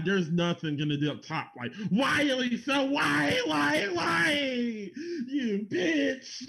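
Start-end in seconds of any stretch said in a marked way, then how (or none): 1.9-6.4 Tommy Wiseau impression. Imitating the movie's famous scene for friends, crying out. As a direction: wail it like the Room's betrayed hero. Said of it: "nothing's gonna top that".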